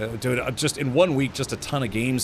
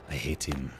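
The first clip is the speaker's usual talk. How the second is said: low, quiet attempted French accent; softly resentful, in a makeshift French accent; fake French accent; 8 semitones lower, 10 dB quieter than usual. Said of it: "It's supposed to be French".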